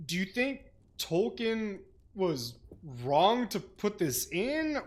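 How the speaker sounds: ordinary delivery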